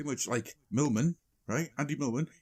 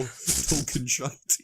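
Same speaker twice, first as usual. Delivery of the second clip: drawn-out punchline; 3 semitones higher, 4 dB louder, much slower than usual. Real, not acted. Punchline of pun title.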